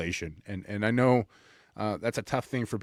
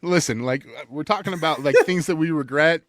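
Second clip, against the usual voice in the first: laughing; 7 semitones higher, 6 dB louder than usual. Real, not acted. Laughing after being teased.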